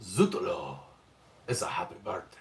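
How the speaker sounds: put-on low French-accented murmur; Zesty Baba Yaga, French accent. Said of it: "That was Zesty Baba Yaga".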